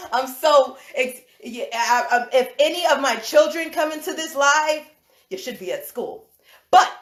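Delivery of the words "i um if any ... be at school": ordinary talk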